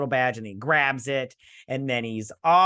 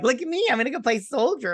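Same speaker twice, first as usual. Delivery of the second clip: mocking high voice; 11 semitones higher, 3 dB louder, faster than usual. Isildur voiced mocking himself.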